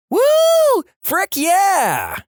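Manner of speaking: very high-pitched whooping scream; whoop, then scream at the top of your lungs. Acted. Drunk girl stands up in class, screaming. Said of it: "stood up and screamed".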